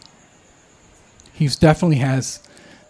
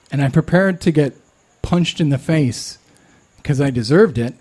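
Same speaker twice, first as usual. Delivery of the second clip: usual speaking voice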